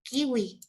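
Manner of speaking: "kiwi" pronounced KI-ui. Shown as a demonstration. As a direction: say "kiwi" with a u-like glide for the w. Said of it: "Y como U, cuando digo".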